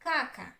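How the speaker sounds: KA-ka, "caca" with long first "a"; wrong first-syllable stress. Shown as a demonstration. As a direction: hit the first syllable of "caca", second short. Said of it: "If the stress was on the first".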